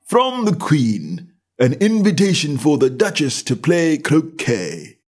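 slow, high and solemn, like a stiff court servant; solemn footman. A narrator's reading. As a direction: announce it gravely, drawn out. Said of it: "in the same solemn tone".

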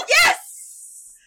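very high, loud excited yes; thrilled squeal. Real, not acted. Co-host hears her friend likes the curly-haired guy, thrilled squeal. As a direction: squeal it out in delight.

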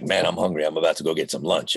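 relaxed low-register everyday voice; really low. Retold or imitated. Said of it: "like, really down here".

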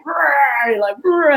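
a growled, building rah; mock angry roar. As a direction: play the fight about to start. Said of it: "it would have been like".

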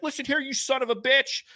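imitating his father yelling at the neighbor; dad cussing out neighbor. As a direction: tell the neighbor off in a hammed-up fury. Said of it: "started cussing the guy out".

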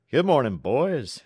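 put-on cheery, lilting call to the boys; genial greeting. A narrator's reading. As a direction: greet them all genially. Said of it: "he said genially".

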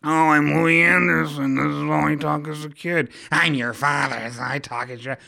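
a hammed-up imitation of the cartoon dad; Louie Anderson impression.

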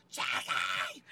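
short mock crowd yell; imitated crowd shout. Crowd answering who they came to see, imitated shout. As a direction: answer like a roaring audience. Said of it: "They go".

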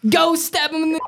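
high, hammed-up soldier's order; Captain Price. Ordering a squadmate as a game captain, high mock command. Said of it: "Ooh, Captain Price?".

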